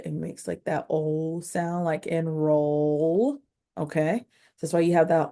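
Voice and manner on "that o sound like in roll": slow stretched roll; stretched OL sound. Shown as a demonstration. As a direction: sound and roll drawn out very slowly. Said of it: "remember when O and L are together".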